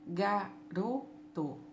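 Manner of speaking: "Garoto" very slow, a gap after each syllable. Shown as a demonstration. Teacher repeats for pupils, "Garoto" in three separate parts. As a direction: sound out "Garoto" as ga, ro, to. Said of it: "em três partes".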